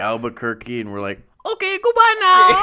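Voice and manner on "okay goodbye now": very high, loud comic voice; silly shouted farewell. Pretend. Performers in Albuquerque signing off, high silly shouted voice. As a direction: shriek a goofy goodbye.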